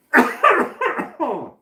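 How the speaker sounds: violent choking cough, high and loud; coughing up water. Real, not acted. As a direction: hack and splutter. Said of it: "went down the wrong pipe".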